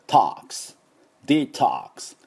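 "トックス" as English "tahks", throat wide open; wide-open American O. Shown as a demonstration. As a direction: say "デトックス" as dee-TAHKS with an open O. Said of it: "Oのところめちゃくちゃ喉を開きますが".

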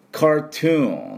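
「カートゥーン」を英語風に後ろを伸ばす; 「カートゥーン」の「トゥーン」を強く長く; 英語発音の手本. Shown as a demonstration. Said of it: "なんて言うかというと". (英語の手本として「カートゥーン」の末尾を長く響かせる)

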